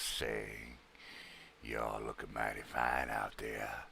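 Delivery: low slow smooth-talker voice; deep suave voice. Pretend. Put-on low voice greeting viewers.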